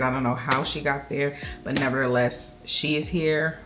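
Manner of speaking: normal talking voice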